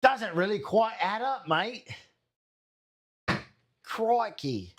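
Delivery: mock Australian accent. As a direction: echo the line as a cartoon Aussie. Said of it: "why we got Australian there".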